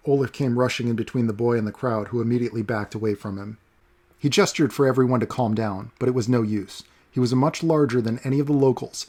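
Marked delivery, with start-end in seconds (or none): none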